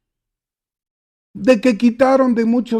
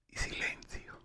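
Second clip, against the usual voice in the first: breathy whisper of silence; 19 dB quieter than usual. A narrator's reading. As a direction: let the word fade into a whisper. Locked silent church.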